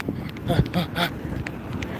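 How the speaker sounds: airy unvoiced laugh, soft; breathless laugh. Real, not acted. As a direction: laugh under your breath while hurrying. Nervous moment crossing the road.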